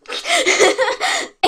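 squealing high giggle at her own drawing. Real, not acted. Gushing over her kitten drawing, a squealing giggle.

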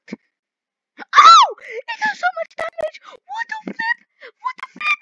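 very high, loud shriek of dismay; shocked scream. Real, not acted. Player to viewers as the new car gets damaged, shocked scream. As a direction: shriek it in outraged disbelief.

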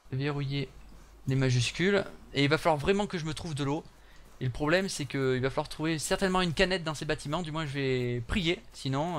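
usual speaking voice

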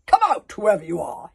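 loud commanding call; loud order. Acted. Grabbit orders whoever is in the cauldron out, loudly. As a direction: order them out like a chief. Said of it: "he ordered".

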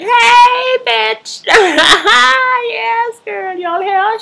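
very high, loud mock squeal; over-the-top, high-pitched greeting; excited shriek. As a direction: imitate a thrilled friend hollering back. Acting T T's excited reply.

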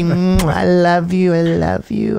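put-on slow, high, swooning drunk lady; mocking a drunk woman cooing; drunk gushing woman. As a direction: coo it like a clingy drunk.

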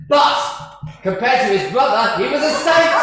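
high, loud triumphant punchline; climbing loud payoff. Retold or imitated. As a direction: fling out the last word. Punchline of priest joke.

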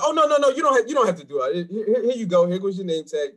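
high, loud hurried apology; flustered greeter. Retold or imitated. Door staff hurrying to let him in, flustered. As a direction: backpedal eagerly. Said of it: "they was like".

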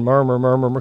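low soft murmur. Shown as a demonstration. Preacher leads congregation in murmuring, low and soft. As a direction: murmur it under your breath, low. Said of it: "just say it over and over again".

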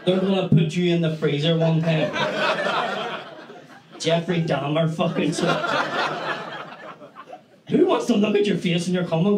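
normal talking voice

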